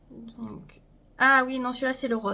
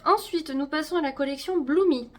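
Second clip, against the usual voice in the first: usual speaking voice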